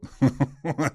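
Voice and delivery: a quick real laugh after the joke; short laugh. Real, not acted. Laughing at his own joke to the host. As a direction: laugh it off lightly.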